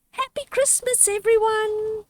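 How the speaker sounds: high, bouncy little mouse; young mouse voice. Acted. Brother mouse greeting his family, high and happy. Sam dancing around the nest.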